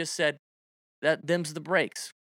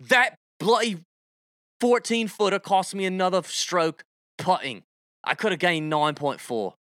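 put-on light Australian accent, grumbling about a missed putt; a touch of Aussie, whining about strokes; mock Aussie complaint. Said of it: "Now you sound a little Australian".

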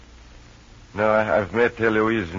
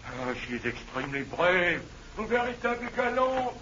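1 delirious; 12 semitones higher than usual. Acted. Delirious man praises Eloise, high then fading. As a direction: rave high, then let it fall away. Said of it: "getting delirious again".

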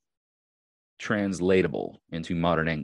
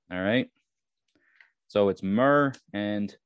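ordinary talk

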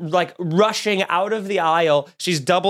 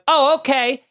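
miffed; 7 semitones higher, 3 dB louder than usual. Retold or imitated. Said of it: "I just said out loud to them, I went".

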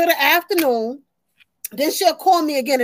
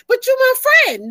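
daughter's plea; 7 semitones higher than usual. Retold or imitated. Mother imitates her daughter pleading, higher. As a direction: plead like the clingy child.

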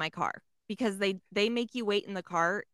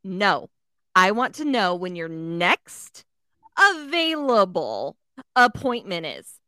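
slow, louder, spelled-out demand; firm slow retold insistence; 5 dB louder, slower than usual. Insisting to the receptionist, slow and louder, mildly acted. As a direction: lean on each word slowly, pressing harder. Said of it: "And I said".

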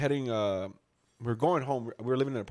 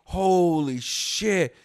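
slow, higher, drawn-out amazement; retold stunned reaction; 4 semitones higher, 3 dB louder, much slower than usual. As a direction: stretch it out in awe at the crash.

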